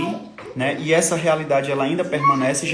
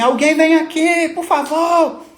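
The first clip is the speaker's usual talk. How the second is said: high, loud desperate cry for help; desperate shout; 12 semitones higher, 6 dB louder, slower than usual. Retold or imitated. Father in the ICU calling staff, desperate shout. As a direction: yell across the ward for someone to come. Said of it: "E eu falei isso gritando".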